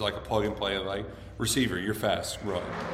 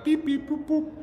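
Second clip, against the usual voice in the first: beep boop noises. Retold or imitated. Mimicking phone app taps.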